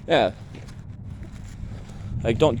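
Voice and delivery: ordinary talk, nothing marked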